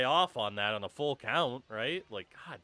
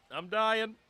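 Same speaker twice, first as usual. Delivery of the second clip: higher, strained choking cough; choking, louder; 8 semitones higher, 3 dB louder than usual. Real, not acted. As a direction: force the words out while choking. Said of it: "The water went down the wrong pipe".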